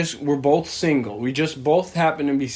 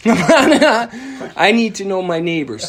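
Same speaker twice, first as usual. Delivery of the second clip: laughing through the words, higher and louder; 7 semitones higher, 5 dB louder, slower than usual. Real, not acted. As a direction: crack up while saying it. Laughs while retelling the joke.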